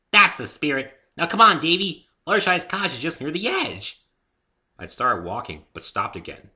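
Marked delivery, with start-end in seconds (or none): 0.1-4.0 high, loud, bouncy pony voice; high loud cheerful pony girl; bubbly Pinkie. A narrator's reading. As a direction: chirp it like an excitable pony.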